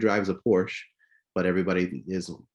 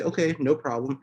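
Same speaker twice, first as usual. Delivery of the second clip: easygoing reply; 7 semitones higher than usual. Shown as a demonstration. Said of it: "go by tone of voice".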